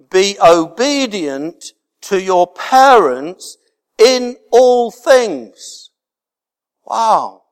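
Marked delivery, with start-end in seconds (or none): 0.1-5.9 slow, rising to high on all things; emphatic reading. Acted. Reading verse with weight.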